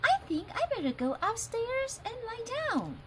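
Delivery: put-on lightly high little girl voice; a hint of a tired little girl; Goldilocks. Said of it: "said Goldilocks".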